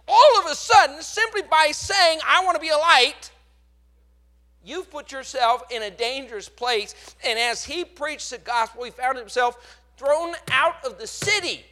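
loud, high preaching shout; preaching climax. Acted. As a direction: lift the whole room with it.

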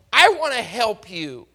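ordinary delivery

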